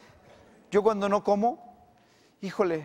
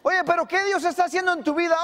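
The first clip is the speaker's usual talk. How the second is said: much higher, louder, fast prodding voice; eager question; 13 semitones higher, 4 dB louder, faster than usual. Acted. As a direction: poke at him eagerly. Friend pressing question.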